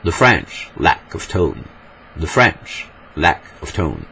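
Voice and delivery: slow, theatrical English accent; hammed-up English accent, drawn out with falling stresses; overacted English accent. Shown as a demonstration. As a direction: exaggerate the English accent, stretching each stressed word. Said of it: "En anglais surjoué, on dirait".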